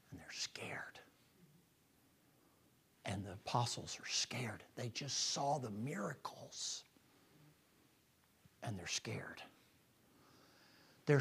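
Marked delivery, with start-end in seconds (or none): 0.1-9.4 soft sermon whisper; hushed whisper. Real, not acted. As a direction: whisper it, almost breathless. Preacher drops to a hush.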